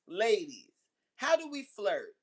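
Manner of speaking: ordinary delivery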